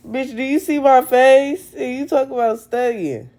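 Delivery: high, loud and fed up; exasperated outburst. Real, not acted. Fed up, telling off someone who brings up studying. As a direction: snap back at her for mentioning study.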